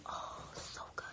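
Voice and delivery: breathy whisper of delight. Real, not acted. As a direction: whisper it like the taste is still with you. Savoring the sushi.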